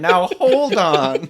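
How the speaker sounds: loud, high, drawn-out appeal; urgent protest. Acted. Player pleads with an armed companion, slow urgent protest. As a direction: stretch it out to halt a fight.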